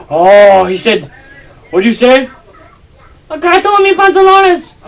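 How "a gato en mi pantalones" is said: high squeaky Spanish voice; bad guy speaking Spanish. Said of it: "He says there's a cat".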